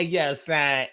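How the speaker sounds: ordinary talk, nothing marked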